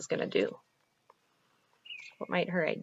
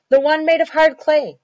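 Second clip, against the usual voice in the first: loud, raised-pitch order; commanding father; 10 semitones higher, 13 dB louder than usual. Acted. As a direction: call out the order to the children. Hungry father orders the children.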